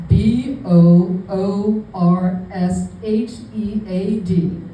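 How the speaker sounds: B O O R S H E A D, slowly, letter by letter; deliberate spelling out. Retold or imitated. Reading out the misspelled vendor name, slow and deliberate.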